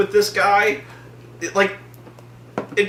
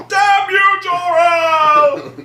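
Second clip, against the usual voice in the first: high, loud theatrical curse; villain's cursing shout; 13 semitones higher, 5 dB louder, much slower than usual. Acted. Zod cursing Jor-El, villain shout. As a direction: bellow it in mock fury.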